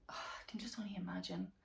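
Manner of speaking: breathy sigh into a whisper; real whisper. Real, not acted. Vlogger reacting to the son's murder in a sighing whisper. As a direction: barely voice it, sighing. Horrified aside after grim detail.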